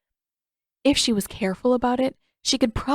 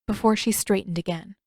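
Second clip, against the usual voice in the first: plain reading voice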